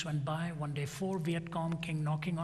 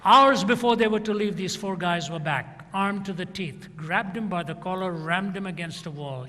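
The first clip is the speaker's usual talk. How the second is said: much louder, driving narration; dramatic climax; 3 semitones higher, 10 dB louder than usual. A narrator's reading. Preacher building to the soldiers' return, much louder. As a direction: hammer the climax out loud.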